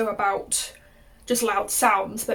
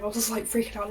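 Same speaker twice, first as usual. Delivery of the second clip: ordinary talk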